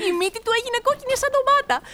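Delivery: high, giggly storytelling; amused telling. Acted. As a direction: tell it gleefully, voice lifted with amusement. Amused tale of drunk man. (εύθυμη, γελαστή αφήγηση)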